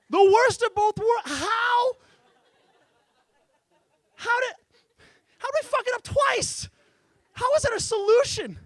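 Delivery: high, loud incredulous rant; hammed-up exasperation; exasperated disbelief. Real, not acted. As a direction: throw up your hands in disbelief. Exasperated rant about dryers.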